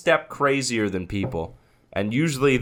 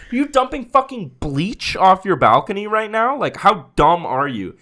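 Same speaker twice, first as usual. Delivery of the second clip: higher, louder mock tirade; retold yelling; 9 semitones higher, 5 dB louder than usual. Narrator scolding neighbor about bleach, angry. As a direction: tear into him. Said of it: "And I was like".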